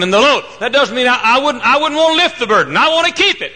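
high, loud pulpit declaration; preaching at full force. Acted. Preacher telling the church he keeps his burden, preaching at full force. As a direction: proclaim it, voice soaring over the pews.

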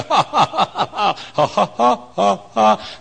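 high, loud staccato ha-ha; exuberant acted laughter. Saved sinner who wants to laugh and laugh, high staged laughter. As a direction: let out a long string of loud ha's. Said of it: "You just want to laugh and laugh".

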